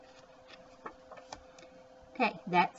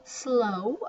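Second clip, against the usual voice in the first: normal talking voice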